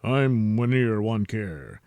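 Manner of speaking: low, measured anchorman read; news anchor. Acted. Wiener Wanker reporting the monster attack, low anchorman voice. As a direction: deliver the news with mock gravity.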